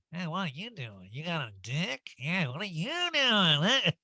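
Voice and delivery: swooping, put-on creepy voice; mock sleazy come-on. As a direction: ham up a dirty pick-up line. Joking creepy come-on to celebrity.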